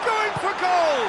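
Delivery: high, rising-stakes football call; excited commentary. Real, not acted. Live call of shot.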